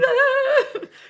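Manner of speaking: a loud, high laugh through tears; high burst of laughter. Real, not acted. After saying she'll start crying, high teary laugh. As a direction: laugh off the urge to cry.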